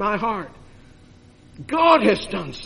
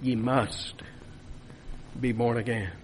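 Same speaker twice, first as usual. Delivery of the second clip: hushed; 9 semitones lower, 11 dB quieter than usual. Real, not acted. Quiet hushed repetition of the text.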